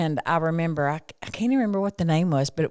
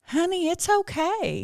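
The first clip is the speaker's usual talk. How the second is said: reassuring mom; 11 semitones higher, slower than usual. Retold or imitated. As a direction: soothe your son gently. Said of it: "And I'm like".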